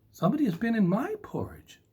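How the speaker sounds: high, quick mama bear. Acted. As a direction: squeak it out like a flustered mama bear. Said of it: "said Mama Bear".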